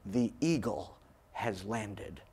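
low, slow, hushed announcement; quiet pride. Retold or imitated. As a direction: intone it like a solemn mission report. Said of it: "with such beaming pride in his face".

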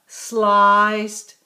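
"sliced" said loud with a long drawn-out I vowel; stretched I sound. Shown as a demonstration. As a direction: hold the I in "sliced" so the children hear it. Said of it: "Do you hear the I sound in sliced".

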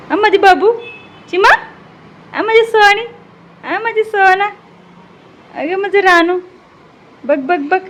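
tender singsong cooing to a pet. Real, not acted. Cooing pet names to cat.

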